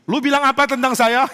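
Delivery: high, loud indignant challenge; angry David voice. Retold or imitated. David in a dream angrily confronting the preacher, high and loud. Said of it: "Mulai begini".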